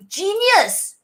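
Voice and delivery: high, slow gushing exclaim; excited praise. Real, not acted. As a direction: shout it out in delight. Loud first take.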